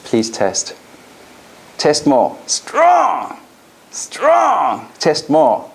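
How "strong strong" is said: high, firm, ringing declaration; a powerful, commanding call of strong; strong tonality. Shown as a demonstration. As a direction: push the word out with force. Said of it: "Strong tonality".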